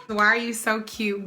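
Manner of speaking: normal talking voice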